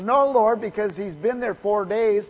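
high, loud, worked-up protest; excited. Retold or imitated. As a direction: blurt it out in a panic about the smell. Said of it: "got all excited and she says".

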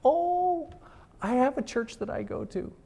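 lightly voiced old lady. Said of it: "some little gentle old lady says".

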